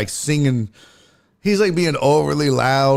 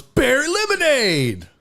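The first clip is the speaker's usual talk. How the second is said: high, drawn-out holler; heckler's yell; 13 semitones higher than usual. Retold or imitated. Heckler yelling at comic walking onstage, high drawn-out yell. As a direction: imitate a heckler hollering a name, pitched way up. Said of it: "I can hear him yelling".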